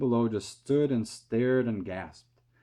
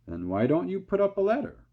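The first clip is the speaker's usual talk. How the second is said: pitched well up, teasing; police chief needles the fire chief, teasing high; high mock suggestion; 11 semitones higher, 4 dB louder than usual. Police chief needles the fire chief with a high teasing question.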